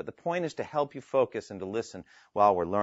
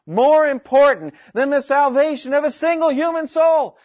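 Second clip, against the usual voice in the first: loud, high-pitched imitation of an impassioned preacher; Rick Warren; 12 semitones higher, 8 dB louder than usual. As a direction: mimic a preacher's shouted challenge to the crowd. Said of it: "Rick Warren kept going like this".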